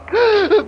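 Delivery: loud, high gasp of fright; sharp startled intake of breath; horrified gasp. Acted. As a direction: gasp in sudden horror. Finds people in the dark room.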